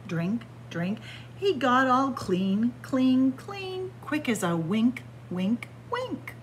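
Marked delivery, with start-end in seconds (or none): none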